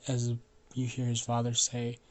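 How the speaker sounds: usual speaking voice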